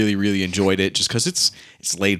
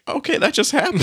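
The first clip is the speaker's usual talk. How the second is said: mock stunned. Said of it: "I was like".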